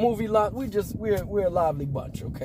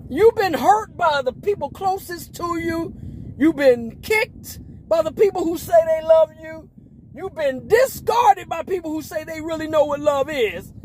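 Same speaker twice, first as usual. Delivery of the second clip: impassioned preaching; 7 semitones higher, 3 dB louder than usual. Acted. Preacher urging on the hurt and discarded, impassioned. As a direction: preach it high with rising force.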